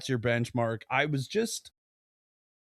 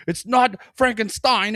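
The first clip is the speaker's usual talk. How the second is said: high, loud exasperated correcting; movie doctor voice; 11 semitones higher, 6 dB louder than usual. Retold or imitated. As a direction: insist on the name, high-pitched. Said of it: "when he's like".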